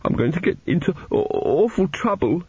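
put-on high, stammering child's worry; worried little boy. A narrator's reading. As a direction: fret in a small boy's high voice. Narrator voices worried Arthur.

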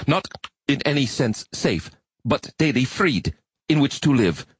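high, earnest alien reading aloud; earnest arachnid recital. Acted. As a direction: recite solemnly as a proud spider creature. Arachnid reads its translation.

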